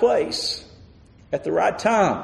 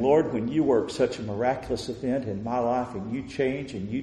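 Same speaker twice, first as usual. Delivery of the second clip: quiet vow; 5 semitones lower, 7 dB quieter than usual. Acted.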